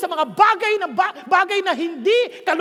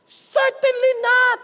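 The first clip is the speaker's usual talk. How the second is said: high, drawn-out declamation; emphatic refusal; 5 semitones higher, 4 dB louder, much slower than usual. Acted. Preacher voices Paul's answer from Romans, emphatic. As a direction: proclaim it slowly on a raised pitch.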